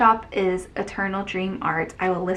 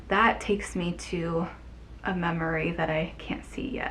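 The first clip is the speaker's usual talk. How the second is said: hushed whisper; 4 semitones lower, 6 dB quieter than usual. Real, not acted. Dreamy aside while smelling wax.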